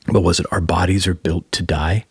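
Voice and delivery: usual speaking voice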